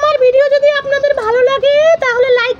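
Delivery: put-on high little boy asking viewers to like; a toddler's sweet plea to the audience; child voice. Little son asking viewers to like and comment, in a high child voice.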